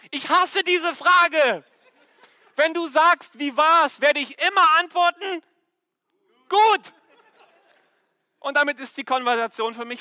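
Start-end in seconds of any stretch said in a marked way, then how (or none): 0.1-6.9 loud, high retold complaint, ending on a sharp high one-word answer; exasperated outburst. Husband telling his wife he hates her question, exasperated outburst. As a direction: protest loudly as if fed up with the question.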